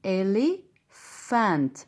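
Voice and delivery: إليفانت spelled out slowly syllable by syllable; slow elephant. Shown as a demonstration. Teacher slowly modelling elephant for the class. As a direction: pull إليفانت apart slowly. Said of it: "أو كلمة زي كنا".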